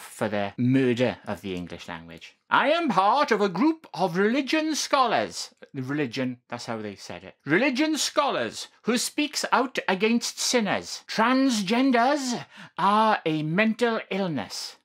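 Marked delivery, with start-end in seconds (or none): 2.5-5.5 higher, booming hellfire preacher reading. Acted. Vlogger reads a hate message as a preacher, booming. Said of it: "I'm doing my best preacher voice here". 7.5-14.8 higher, booming hellfire preacher reading. Acted. Vlogger reads a hate message as a preacher, booming. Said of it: "I'm doing my best preacher voice here".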